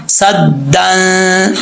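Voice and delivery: صدن with the nun hidden in a nasal hum, one alif long; ghunna of ikhfa. Shown as a demonstration. Teacher showing ikhfa ghunna on صدن. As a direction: hum the n of صدن through the nose. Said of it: "ایک الف کی مقدار غنہ کریں گے".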